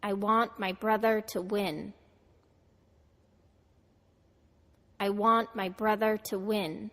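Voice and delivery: "I want my brother to win" with want, brother, win stressed; stress on content words. Shown as a demonstration. Teacher models for students to repeat, content words stressed. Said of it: "Americans stress words in sentences that are important".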